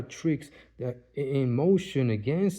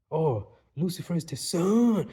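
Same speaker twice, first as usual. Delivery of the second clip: a lightly voiced enemy saying Lucifer is the sun; imitating their voice, raised pitch; mock taunting quote; 4 semitones higher than usual. Enemies taunting him about the sun, lightly imitated. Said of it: "They said it with".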